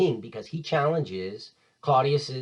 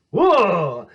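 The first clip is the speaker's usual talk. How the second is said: wowed exclamation; 6 semitones higher, 10 dB louder than usual. Real, not acted. Teacher reacts to speech.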